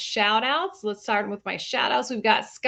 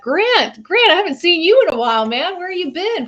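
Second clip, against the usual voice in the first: much higher, louder hello; excited greeting; 8 semitones higher, 7 dB louder than usual. Real, not acted. Host greeting returning viewer Grant, thrilled.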